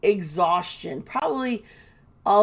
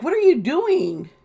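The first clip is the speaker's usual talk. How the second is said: retold indignant question, pitched high; high-pitched, appalled mock confrontation; indignant challenge; 8 semitones higher, 4 dB louder than usual. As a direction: imitate someone confronting her in disbelief.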